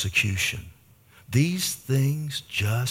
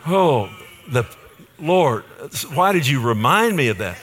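louder mock groan to the Lord; mock lament; 3 dB louder than usual. Real, not acted. Preacher groaning to the Lord over the TCU loss, mock lament. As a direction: ham up the sports grief.

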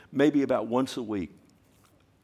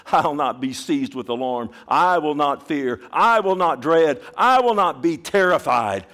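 louder and higher with each I will not; building bold declaration; 5 semitones higher, 10 dB louder than usual. Acted. Preacher voicing the believer's bold confession, building loud. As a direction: proclaim it boldly, rising.

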